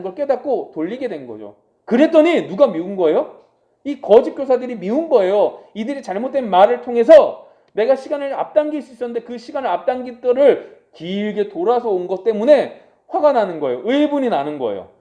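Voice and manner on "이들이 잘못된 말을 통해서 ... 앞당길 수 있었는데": ordinary talk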